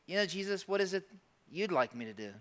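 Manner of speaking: soft, open question to Jesus; humble asking. Acted. A believer humbly asking Jesus what to do, softly. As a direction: ask gently, ready to listen. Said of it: "put my ear to the ground and say".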